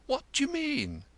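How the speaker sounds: high-pitched alarmed question; startled. Acted. Cantercot questioning the detective's odd remark, startled. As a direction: ask it high, taken aback.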